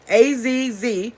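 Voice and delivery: slow "A Z Z", each letter separate; spelled slowly. Shown as a demonstration. Correcting viewers that it's Z Z not S S, slowly spelled. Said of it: "not A S S".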